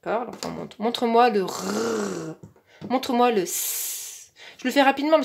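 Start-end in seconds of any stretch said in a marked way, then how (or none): 0.8-4.3 slow, careful instructions. Shown as a demonstration. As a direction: spell out each request unhurried. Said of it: "ça doit être très, vraiment très, très lent".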